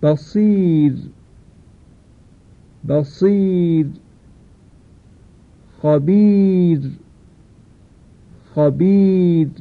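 بصیر and خبیر with a thin, light final ر; recited examples. Shown as a demonstration. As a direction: say بصیر, خبیر slowly, ر kept fine. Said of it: "مانند این دو مثال".

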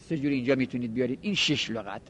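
ordinary delivery

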